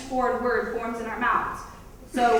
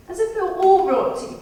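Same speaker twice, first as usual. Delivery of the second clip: mock royal posh English accent, higher and louder; 6 semitones higher, 6 dB louder than usual. Vlogger mocking the English upper class, posh English accent. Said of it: "the posh accent".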